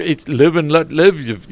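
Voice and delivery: ordinary talk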